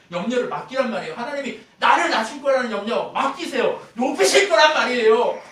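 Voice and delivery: full-force pulpit exhortation; forceful preaching. Real, not acted. Preacher drives the point home.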